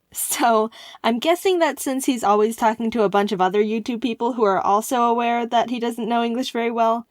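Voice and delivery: ordinary talk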